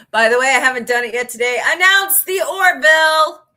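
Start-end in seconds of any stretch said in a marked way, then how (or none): 1.6-3.4 loud, slow, high mock demand; mock shout. Begging the studio for more Orville, loud slow shout. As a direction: bellow the plea slowly. Pleading for show announcement.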